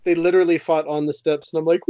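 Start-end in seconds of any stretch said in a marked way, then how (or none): none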